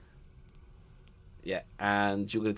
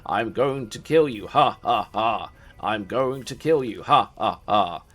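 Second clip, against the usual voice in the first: evil Strahd cackle; 7 dB louder, slower than usual. Acted. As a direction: cackle like a gloating vampire lord. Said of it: "and he's like".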